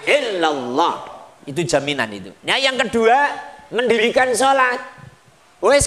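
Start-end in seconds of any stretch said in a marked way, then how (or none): none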